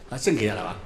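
normal talking voice

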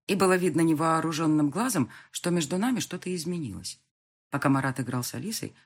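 ordinary delivery, nothing marked

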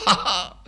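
high, haughty mock laugh; father sneering nobly at his son; noble sneering laugh. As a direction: sneer a lofty ha ha. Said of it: "Ne ricane pas noblement".

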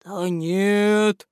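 drawled. A narrator's reading. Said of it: "протянул январь".

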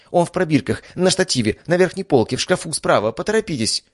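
high, urgent woman's orders; urgent rattling. A narrator's reading. Duchess directing doctor to the cure, high and urgent. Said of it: "начала тараторить Евгения".

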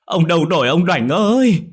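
loud, high wailing lament; bursting into wails. A narrator's reading. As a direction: wail for the lost husband. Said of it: "khóc òa lên".

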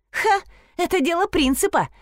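high-pitched, huffy retort; puffing indignantly. A narrator's reading. Nina puffs while fighting over the old divan, huffy. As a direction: puff it out on principle. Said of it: "пропыхтела Нина".

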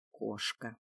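low-pitched rasped insult; hoarse rasp. A narrator's reading. Drunken jarl sneering at the captive, low rasp. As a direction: rasp it low, drawn back in the throat. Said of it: "прохрипел он".

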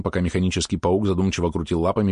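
ordinary delivery, nothing marked

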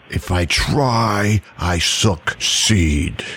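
"If I try, I succeed" slow, low and weighty; slow emphatic delivery. Shown as a demonstration. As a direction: press each word, low and slow. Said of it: "Emphasis on that".

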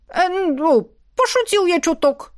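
high-pitched, ingratiating backpedal; obsequious. A narrator's reading. Tsar backing down before Ратмир, fawning. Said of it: "подобострастно улыбаясь".

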